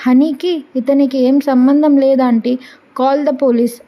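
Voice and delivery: ordinary delivery, nothing marked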